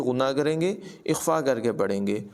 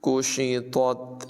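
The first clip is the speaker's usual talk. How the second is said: slow chanted tajweed recitation; Quran recitation; 2 semitones higher, much slower than usual. Shown as a demonstration. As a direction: recite it melodically, drawing out the vowels. (धीमी, सुरीली क़ुरान तिलावत)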